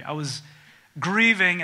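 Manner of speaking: ordinary talk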